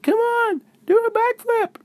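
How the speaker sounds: very high, loud cheeky voice; Lexi teasing. Acted. Lexi urging Izzy to flip, high and cheeky. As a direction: egg her friend on in a squeaky voice. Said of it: "And Lexi said".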